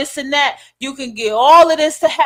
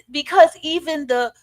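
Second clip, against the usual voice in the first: ordinary talk, nothing marked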